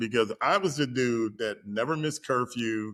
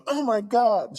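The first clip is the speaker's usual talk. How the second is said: much higher, startled "Oh my God"; shocked gasp, imitating her; 11 semitones higher, 4 dB louder than usual. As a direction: squeal it like she just saw him in a towel. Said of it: "and she goes".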